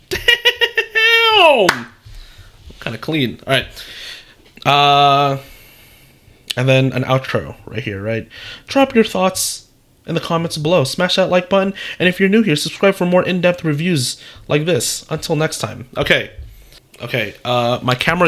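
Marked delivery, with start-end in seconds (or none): 0.1-1.9 a real laughing "Damn!" jumping far higher. Real, not acted. Reacting to the generated script, laughing surprise. 8.7-15.9 higher, upbeat call-to-action voice; youtuber outro read. Acted. Reading the outro script to viewers, upbeat youtuber voice.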